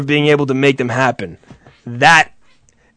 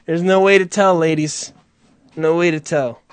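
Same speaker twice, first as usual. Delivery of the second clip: ordinary talk, nothing marked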